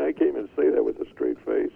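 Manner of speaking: ordinary delivery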